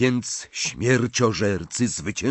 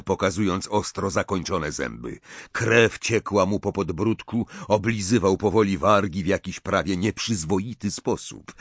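ordinary delivery

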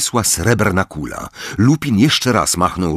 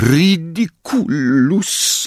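slow, high, moaning Ridiculus; a mother moaning the spell through tears; drawn-out tearful incantation; moaned spell; 8 semitones higher, much slower than usual. Acted. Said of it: "jęknęła pani Wesley".

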